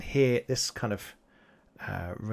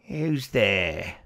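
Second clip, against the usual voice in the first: louder, wary call from behind rocks; a hidden goblin calls out; unseen voice; 5 dB louder than usual. Acted. Goblin behind the rocks challenging the party, wary call. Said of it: "and you hear a voice go".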